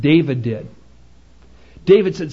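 normal talking voice

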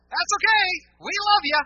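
hammed-up fake welcome; mock gushing. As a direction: chirp it like a phony greeter. Mocking shallow acceptance.

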